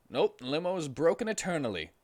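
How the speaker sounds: fast, breezy dismissal; limo driver. Acted. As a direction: brush them off quickly. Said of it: "said the limo driver".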